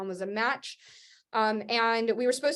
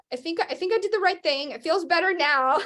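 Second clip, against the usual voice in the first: relieved laugh; 6 semitones higher than usual. Retold or imitated. Relief after recovery, laughs.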